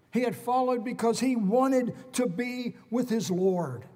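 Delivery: loud, high, rising pulpit fervour; fiery sermon climax; preaching at full force. Acted. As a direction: proclaim it to the whole congregation.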